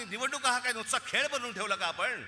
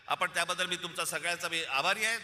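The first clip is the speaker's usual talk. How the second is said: ordinary delivery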